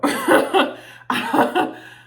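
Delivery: a loud, airy burst of laughter; loud breathy laugh. Real, not acted. Introducing the TikTok reaction, bursting into laughter. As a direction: laugh out loud at your own setup.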